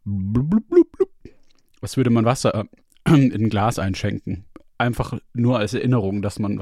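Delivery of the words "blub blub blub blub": bubbling sound. Retold or imitated. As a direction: imitate water glugging. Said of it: "Gluck, Gluck, Gluck Geräusch".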